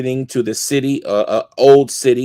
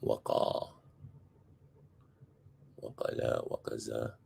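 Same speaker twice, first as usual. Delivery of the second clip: faint, distant muttering while looking it up; muttering off-mic; 14 semitones lower, 22 dB quieter than usual. Real, not acted. Teacher searching the dictionary, half-heard off-mic. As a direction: mumble it away from the microphone.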